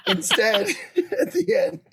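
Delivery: words broken up by high laughter; laughing. Real, not acted. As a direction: try to speak while cracking up. Cracking up at own joke.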